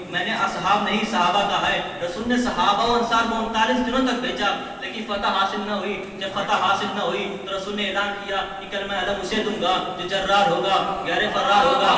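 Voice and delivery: loud, impassioned sermon climax; full-force preaching. Real, not acted. Preacher telling the crowd of Khyber, full-force preaching. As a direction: drive each point home loudly to the crowd.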